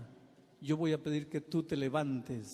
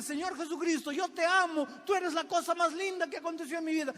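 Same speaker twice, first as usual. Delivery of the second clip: much higher, nearly shouted declaration of love; gushing mock believer; 13 semitones higher than usual. Preacher mimicking a believer's vow to Jesus, hammed-up shout. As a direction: overdo the adoring vow.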